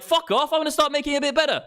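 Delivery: high, hurried competitive yell; mock outrage. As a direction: fire back at the rival in mock fury. Acting out the outdone toy maker.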